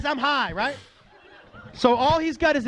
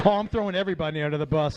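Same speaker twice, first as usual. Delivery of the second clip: ordinary talk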